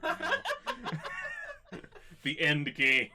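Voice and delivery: talking through laughter; laughing. Real, not acted. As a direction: giggle through the words. GM jokes about giant frog.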